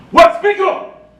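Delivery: very high, loud shout; angry yell. Retold or imitated. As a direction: bellow at her to speak up. Sergeant yells at mother.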